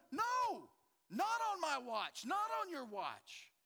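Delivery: retold furious no, loud and high; furious defiance. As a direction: shout it down, refusing to accept it. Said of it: "I'm furious. I'm going".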